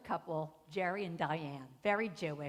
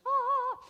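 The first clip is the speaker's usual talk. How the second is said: high, loud held "aah"; high angelic note, played for fun; mock heavenly "ah"; 9 dB louder than usual. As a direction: sing out a mock-reverent aah. Mock choir at personal church.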